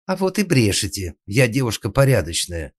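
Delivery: high, loud indignant denial; furious maid defending herself; enraged. A narrator's reading. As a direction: flare up at the accusation. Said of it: "рассвирепела Сандра".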